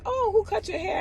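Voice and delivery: a bright question pitched far up; admiring stranger, high. Retold or imitated. Stranger in a store asking about her haircut, high. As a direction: gush a compliment as a question. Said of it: "someone says".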